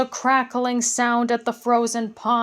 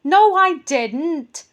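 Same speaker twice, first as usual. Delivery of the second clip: high, loud, slow goblin snap; snapping goblin; 6 semitones higher, 7 dB louder, slower than usual. Acted. Second goblin denies it to the first, snapping. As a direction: snap back like a sulky goblin. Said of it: "snapped the other goblin".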